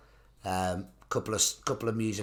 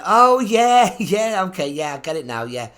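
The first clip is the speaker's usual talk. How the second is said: mock realisation; 7 semitones higher, 9 dB louder than usual.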